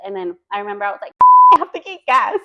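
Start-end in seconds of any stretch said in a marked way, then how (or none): 1.3-2.5 laughing through the words; high, loud, helpless amusement. Real, not acted. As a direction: crack up while saying it, higher and louder. Laughing at her own retelling.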